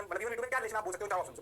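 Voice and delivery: ordinary delivery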